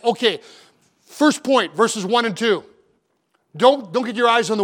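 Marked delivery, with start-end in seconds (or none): none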